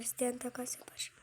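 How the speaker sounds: whispered aside to chat; soft conspiratorial whisper. Real, not acted. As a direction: slip it in as a secret.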